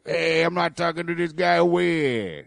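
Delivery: a lightly voiced tough cop, pitched a bit up, sneering; mocking a bad cop impression. Co-host mocks the host's bad cop impression, lightly sneering. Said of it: "It's a very bad impression".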